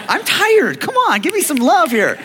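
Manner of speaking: high, playful outburst begging for applause; mock-exasperated plea. Preacher asking the congregation for some love, mock-exasperated cry. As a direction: whoop it up, pleading with the crowd. Preacher pleading for audience response.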